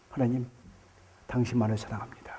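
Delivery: very low, hushed declaration of love; devout confession. Retold or imitated. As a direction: drop right down into a soft, intimate prayer. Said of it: "바로 그 자리에서 뭐라 그래요".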